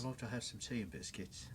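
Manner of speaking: hushed, amateurish British accent. Shown as a demonstration. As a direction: badly attempt a soft, genteel British accent. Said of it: "the softer British".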